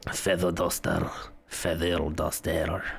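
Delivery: put-on lingering French accent, like the candelabra. Streamer mocking the character name in a French accent. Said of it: "If Ewan McGregor can do that accent".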